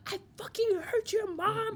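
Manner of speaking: high-pitched mock outburst of a rude girl; rude girl to mom. Retelling a girl on the phone with her mom, mock rude. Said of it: "extremely rude to their parents".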